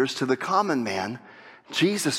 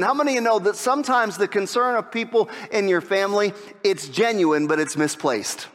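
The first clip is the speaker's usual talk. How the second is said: normal talking voice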